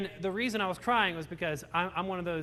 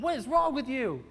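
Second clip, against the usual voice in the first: put-on higher, child-like voice, bluntly asking; little girl voice; 5 semitones higher than usual. Imitating a little girl at the beach, a higher child voice. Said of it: "she's like".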